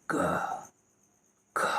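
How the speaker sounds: whisper, breath flowing out easily. Shown as a demonstration. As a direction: let the air slip out without voice. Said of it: "बड़े आराम से निकल रही है".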